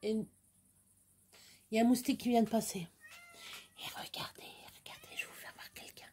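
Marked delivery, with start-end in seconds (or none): 2.8-6.1 whisper. Real, not acted. Whispering not to wake pet.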